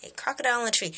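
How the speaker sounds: ordinary talk, nothing marked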